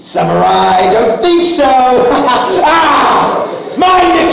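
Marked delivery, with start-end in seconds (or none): 1.7-2.6 loud, high gleeful cackle; villain laugh. Acted. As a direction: cackle with delight.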